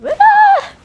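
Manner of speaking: loud high shriek of embarrassment; imitated panicked scream, very high; sudden hammed-up "Ah!" shrieked out; mock horrified scream. Acting out horror at hearing herself.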